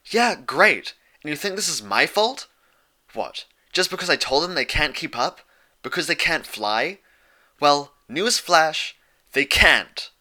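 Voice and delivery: loud, high and defensive, snapping back; indignant pony lashing out at her friend; defensive outburst. A narrator's reading. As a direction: throw it back at her, stung and angry. Dash fighting back at Twilight.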